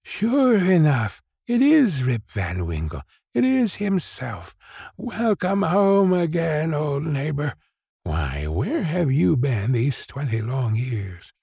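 put-on slow, high old woman's voice; high, slow cry of welcome; exclaiming old woman. A narrator's reading. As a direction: exclaim in drawn-out amazement as an old neighbor.

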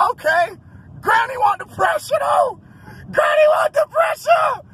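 high, loud, thrilled shouting; excited hollering. Real, not acted. Cheering the granny smoking in her car, shouting high. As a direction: cheer her on at the top of your voice.

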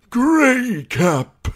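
put-on a trembling old maester naming his poisons; quavering elderly scholar; quavery old man. A narrator's reading. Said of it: "he said in a quavery voice".

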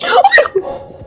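high startled shriek; frightened scream. Real, not acted. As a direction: shriek as it lunges. Monster grabs at her.

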